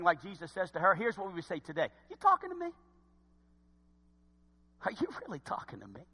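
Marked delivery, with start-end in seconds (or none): 2.1-2.7 very high mock-incredulous question; shocked disbelief. Samaritan woman in today's language to Jesus, shocked. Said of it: "who we are shocked they're speaking to us". 4.9-6.1 quick, hushed mock incredulity, ending on a rise; shocked disbelief. Someone today shocked to be spoken to, incredulous. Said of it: "who we are shocked they're speaking to us".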